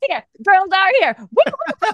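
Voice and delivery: high, whooping party cheer; girls' night woo. Retold or imitated. Speaker imitating girlfriends whooping into a bar, high and excited. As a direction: whoop like friends arriving at the bar. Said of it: "girlfriends walk into a bar and go".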